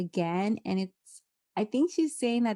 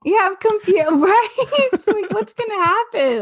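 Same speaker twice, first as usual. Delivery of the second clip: laughing; 11 semitones higher, 9 dB louder than usual. Real, not acted. Laughing, mock confusion.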